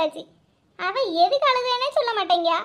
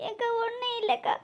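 small, soft weepy voice; acted quiet sobbing denial; tearful; 7 dB quieter than usual. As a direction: brush off the concern through tears. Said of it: "ஏன் மக்களே அழுவுத".

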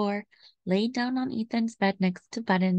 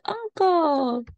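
cried out; 8 semitones higher than usual. A narrator's reading.

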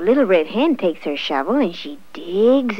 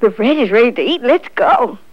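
higher, louder, quick rallying; eager urging; 5 semitones higher, 7 dB louder than usual. A narrator's reading. The goose rushes friends to eat bread, eagerly. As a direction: hurry everyone along eagerly.

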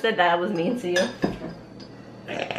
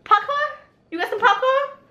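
high put-on accent, R-less "Popcorn"; mocked reality-show accent. Woman showing how the show's cast talk, high mock accent. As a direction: imitate their accent way up high, "popcorn" as "pahpcoah". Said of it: "Like, they talk like that".

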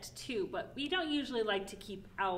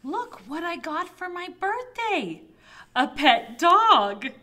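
high, thrilled announcement, louder and drawn out at the end; excited child; 8 semitones higher, 14 dB louder than usual. Acted. Child showing off a birthday present, acted excitement. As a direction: show off a birthday gift with big excitement.

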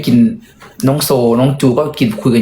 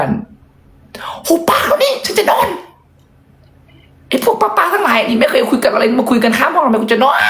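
much higher, shrill imagined yell; shouting; 12 semitones higher, slower than usual. Pretend. Inner voice yelling at chatty housekeepers to shut up, shrill shout. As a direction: scream it out, fed up and sleepless. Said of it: "ก็เลยตะโกนไปบอกแม่บ้านว่า".

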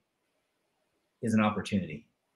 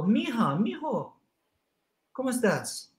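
lightly voiced loving papi; tender father; 9 semitones higher than usual. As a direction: coax the child gently. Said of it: "you go".

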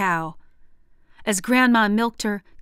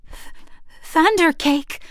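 stammering; 8 semitones higher than usual. Acted. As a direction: stutter it out, scared. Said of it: "I stammered".

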